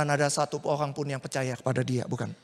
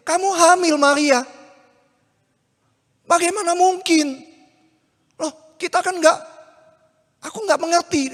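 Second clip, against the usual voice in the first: much higher, louder disbelieving cry; stunned, demanding an answer; shocked outburst; 11 semitones higher, 7 dB louder than usual. Acted. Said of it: "Kaget dia". (Yusuf kaget, berseru tinggi tidak percaya)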